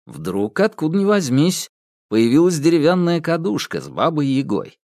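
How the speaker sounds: ordinary delivery, nothing marked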